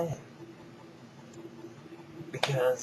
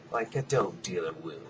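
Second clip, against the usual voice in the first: usual speaking voice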